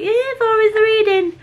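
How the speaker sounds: high, laughing cry; laughing high squeal. Real, not acted. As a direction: laugh through the words. Laughing at herself.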